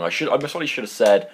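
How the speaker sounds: ordinary talk, nothing marked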